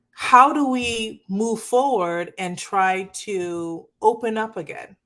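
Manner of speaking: usual speaking voice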